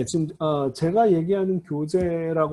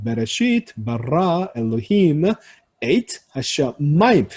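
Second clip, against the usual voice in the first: "베레시트, 바라, 엘로힘" each leaning on its last syllable, "하샤마임" on 마; wave-like reading rhythm; slower than usual. Shown as a demonstration. Teacher demonstrating Hebrew rhythm, words rolling in waves toward late stresses. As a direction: read word by word in waves, stretching the stressed syllables. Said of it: "파도를 탑니다".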